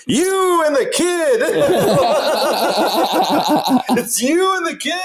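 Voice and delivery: mock high yell. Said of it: "I just hear".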